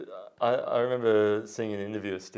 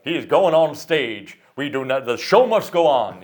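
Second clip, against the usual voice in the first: Colonel's shout; 4 semitones higher, 12 dB louder than usual. Retold or imitated. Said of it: "And the colonel's like".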